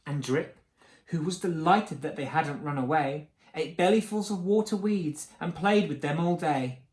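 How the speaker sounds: plain reading voice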